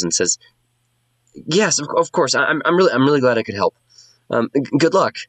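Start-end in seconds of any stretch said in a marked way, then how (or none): 1.5-5.2 much higher, stammering and shy; flustered bard. Acted. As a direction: trip over the words, eager to please.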